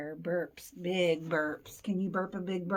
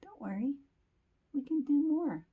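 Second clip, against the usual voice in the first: lightly voiced, higher little mouse; reassuring mouse; 6 semitones higher than usual. Mouse tells Bear not to worry, lightly squeaky reassurance. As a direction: pitch it up to comfort a friend. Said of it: "he's telling Bear".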